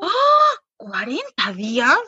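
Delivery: hammed-up horrified gasp; shocked disbelief. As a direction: act appalled at the news. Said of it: "y yo".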